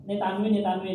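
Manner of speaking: ordinary delivery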